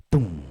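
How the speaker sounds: loud drawn-out boom; delete sound effect. Shown as a demonstration. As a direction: boom it like something blown away. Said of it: "my favorite sound to do when I delete".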